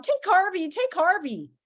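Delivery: high whiny begging; mock tearful plea, offering someone else up; high-pitched acted sobbing. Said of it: "he sobbed".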